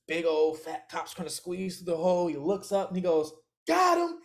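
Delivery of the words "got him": high and louder, gloating; triumphant cop. Retold or imitated. Fat cop spotting him in the crawl space, triumphant call. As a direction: announce the catch with glee.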